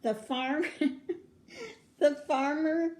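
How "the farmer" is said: laughing. Real, not acted. Laugh mid-word while reading.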